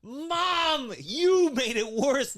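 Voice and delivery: high, drawn-out whine at mom; hammed-up high accusation; mock blaming wail. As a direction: wail it like a wronged child. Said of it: "have a target where".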